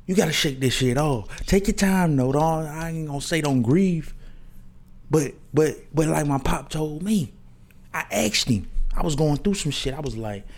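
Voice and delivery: normal talking voice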